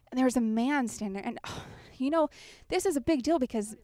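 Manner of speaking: ordinary talk, nothing marked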